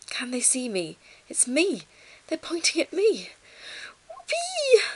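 high, rising to a squealed whoop; mounting excitement. A narrator's reading. Ruby realising they want her, mounting excitement. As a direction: build from hopeful wonder to loud glee.

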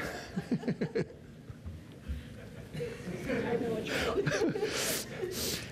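long breathless fit of laughing; real laughter. Real, not acted. Preacher cracking up while telling the Holy Grail story, breathless laugh. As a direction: laugh helplessly at the memory. Preacher recalling Monty Python scene.

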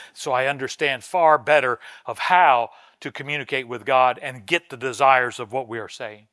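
normal talking voice

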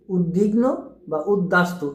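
উদ্বিগ্ন said "ud-dig-no" with a doubled d; mock mistaken উদ্বিগ্ন and উদ্বাস্তু; wrong doubled pronunciation. Shown as a demonstration. Teacher imitates a common mistake. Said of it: "এভাবে উচ্চারণ করে".

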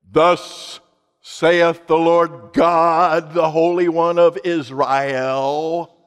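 slow, drawn-out mock-prophet boom; solemn declaration in a put-on prophetic voice; booming prophetic proclamation. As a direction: proclaim it grandly, stretching out the words. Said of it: "You don't judge the inflection of their voice".

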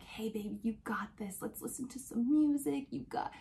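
hushed, half-whispered encouragement; husband's gentle whisper to his wife during surgery. Retold or imitated.

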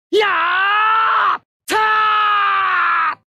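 long, high drawn-out attack yell; charging battle cry. Acted. Pilot charging at the enemy, long battle cry. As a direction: yell it out and hold the vowel.

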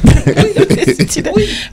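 slow, high laughing remark. Real, not acted. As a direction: laugh through it slowly. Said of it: "we have some voice now".

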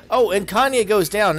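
high, loud sudden call; excited call. Real, not acted. Caster reacts to a knockout.

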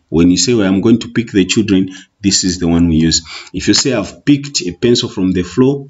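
ordinary talk, nothing marked

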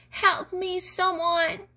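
high-pitched call for help; shouted plea. A narrator's reading. Tied-up gnome calls for someone to save him, shouting. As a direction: call out high to anyone in earshot. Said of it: "shouted the gnome".

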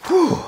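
high, breathy puff of relief; exhausted whew. Acted. Winded by the creator's endless task list, an acted whew. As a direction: blow out a mock-weary whew.

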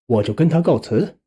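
ordinary delivery

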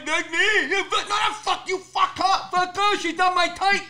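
high, different voice, insisting; imitated deaf pharmacist cursing; deaf guy's voice. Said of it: "The deaf guy goes".

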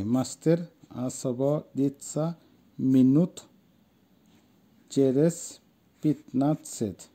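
very slow, each word set apart; pronunciation demo. Shown as a demonstration. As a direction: model it word by word with long pauses. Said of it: "আসেন উচ্চারণটা দেখি". (প্রতিটি শব্দ ধীরে, আলাদা করে উচ্চারণ)

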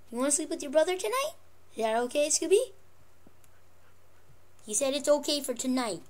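usual speaking voice

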